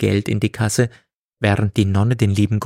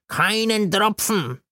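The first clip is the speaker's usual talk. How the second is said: put-on slow, odd drunken voice; lying drunk; 11 semitones higher than usual. A narrator's reading. God lies to Gailana about drinking, slow and slurred. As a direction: lie badly, slowly. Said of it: "log der liebe Gott".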